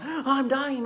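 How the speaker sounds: high, loud mock panic. To the doctor, a hammed-up high cry of panic. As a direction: play up the panic, half joking. Telling the doctor she's dying.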